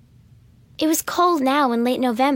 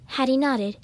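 plain reading voice

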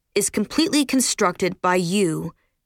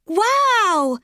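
fake excitement; 13 semitones higher than usual. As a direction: overplay the amazement. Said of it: "Bree joined in the charade".

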